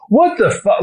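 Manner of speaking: high, startled what the; shocked outburst. Retold or imitated. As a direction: burst out in disbelief. Reacting to scam call.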